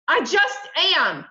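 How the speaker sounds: loud, high, drawn-out outburst; angry shout. A narrator's reading. Mother silencing her son's protest, angry shout. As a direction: shout it to end the argument. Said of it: "my mother shouted".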